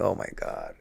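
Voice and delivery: breathless whisper of dismay; whispered dread. Retold or imitated. Man realizing what he agreed to, whispered dismay. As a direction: mouth it in disbelief, barely voiced. Dread after wife's anger.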